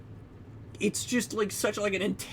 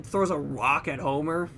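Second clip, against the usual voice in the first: ordinary delivery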